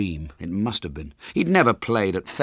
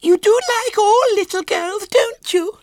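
very high, loud insistence; quavering little girl; 9 dB louder than usual. A narrator's reading. Small girl presses William, high and tearful. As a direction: press him in a tearful child squeal. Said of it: "she persisted with a quavering lip".

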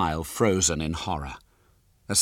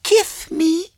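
put-on very high little girl voice; squeaky small child, louder; Violet Elizabeth; 7 dB louder than usual. A narrator's reading. Small girl questions her visitor, high and childish. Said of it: "she said".

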